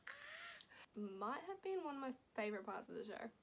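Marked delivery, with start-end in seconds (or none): 0.1-1.0 high, breathy little "Ah"; a soft overwhelmed gasp; delighted sigh. Retold or imitated. Reacting, near tears.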